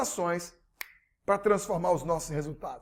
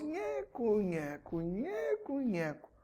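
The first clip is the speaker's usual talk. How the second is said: chair creak; 2 semitones higher, 11 dB quieter, much slower than usual. Retold or imitated.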